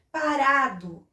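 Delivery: "Parado" slowly, stretching RA; "pa-RA-do", drawn out; strong syllable shown. Shown as a demonstration. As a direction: sound out "Parado" with weight on RA. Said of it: "é o rá".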